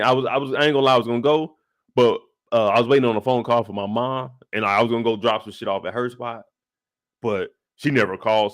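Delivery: normal talking voice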